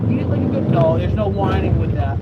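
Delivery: loud, sharp telling-off; scolding. Real, not acted. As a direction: cut the whining short. Scolding whining dog.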